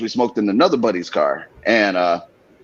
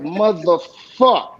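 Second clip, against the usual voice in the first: slow dread; 3 semitones higher, much slower than usual. Retold or imitated. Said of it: "I'm like".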